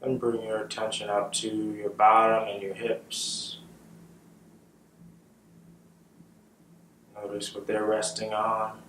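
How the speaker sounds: calm, unhurried meditation guide with long pauses; measured, settled voice for leading meditation; guided meditation. Real, not acted. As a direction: guide them gently into the breath, leaving space after each phrase.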